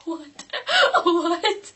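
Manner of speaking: high, helpless laughter breaking into words; real laugh that sounds close to tears; half crying, half laughing. Real, not acted. Storyteller overcome by the absurd detail, laughing and crying. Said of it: "whether I'm crying or laughing".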